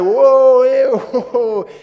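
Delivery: very loud, high mock whoas; hammed-up loud, high alarm; alarmed parents imitated. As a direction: hoot in exaggerated shock.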